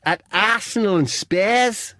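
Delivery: slow, high attempt at Scouse; badly faked Scouse footballer; Wade Rooney. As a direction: stretch it out in high mock Scouse. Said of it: "that's not Scouse".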